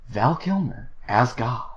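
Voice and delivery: imitating a soft-voiced announcer, pitched slightly up; gentle mock announcer for an anime film; soft-voiced anime trailer guy. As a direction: read it like a cheap anime movie promo, softly. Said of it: "he has a very soft voice".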